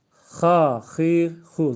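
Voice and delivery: "ha, hi, hu" pushed from the throat, loud and apart; throaty ha hi hu. Shown as a demonstration. Teacher loudly shows students the throat sounds. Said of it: "Özellikle mahreçli olarak okuyorum".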